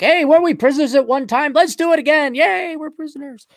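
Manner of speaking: high, loud, cheering voice; mock gleeful prisoners. Host mimics Australians giving up freedom, mock gleeful. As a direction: squeal it like giddy volunteers for jail.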